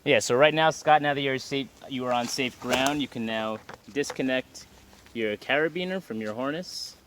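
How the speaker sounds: loud, carrying call down the slope. Real, not acted. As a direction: project instructions across the distance. Belayer calls instructions across slope.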